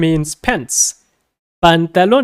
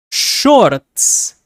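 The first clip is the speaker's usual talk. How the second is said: shorts with a rolled Spanish accent. Shown as a demonstration. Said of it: "put a Spanish accent to it".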